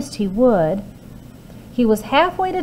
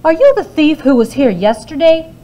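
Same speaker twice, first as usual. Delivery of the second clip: high, loud suspicious demand; giantess demanding; 8 semitones higher, 6 dB louder than usual. A narrator's reading. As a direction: accuse the thief. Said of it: "she demanded".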